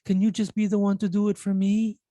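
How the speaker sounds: high-pitched mock protest; reluctant elderly dad. Son imitating his dad refusing a phone lesson, high-pitched protest. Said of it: "when I teach my dad".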